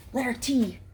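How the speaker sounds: ordinary delivery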